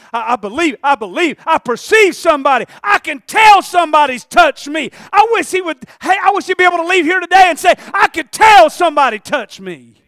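loud, rising into high preaching; building sermon shout. Acted. Preacher voicing Jesus noticing the touch, building sermon shout. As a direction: build to a full-voiced proclamation.

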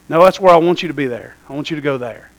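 low, quiet voice of God insisting. Retold or imitated. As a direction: speak as God, low and settled. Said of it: "You know what he said?".

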